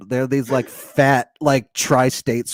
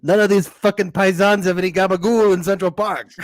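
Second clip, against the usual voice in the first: wiseguy demanding his gabagool; a touch of Sopranos mobster; New Jersey mobster accent; 4 semitones higher than usual. Pretend. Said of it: "Tony Sopranos".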